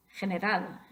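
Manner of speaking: general stressed on the last syllable, "ral"; final-syllable stress. Shown as a demonstration. Teacher tests rule two, general stressed on last syllable. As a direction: lean on "ral" at the end of general. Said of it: "más fuerte en la última sílaba".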